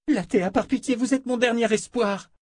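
high, urgent begging voice; desperate pleading. A narrator's reading. Jensen begs her last hope, desperate pleading.

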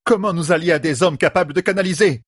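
high-pitched indignant question. A narrator's reading. A sister objects to allying with men, indignant. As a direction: protest as if the idea were outrageous. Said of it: "s'indigna Variline".

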